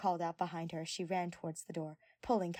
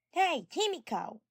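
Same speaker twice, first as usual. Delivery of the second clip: loud high call across the room; 10 semitones higher, 8 dB louder, slower than usual. A narrator's reading. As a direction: call her name from afar.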